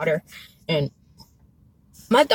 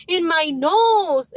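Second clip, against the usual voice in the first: slow, higher-pitched imitation of a panicked child; mock panic; 6 semitones higher, much slower than usual. Mom voicing her son explaining where it is, mock panic. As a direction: imitate a distressed son, drawn out. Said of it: "panicking, and he's like".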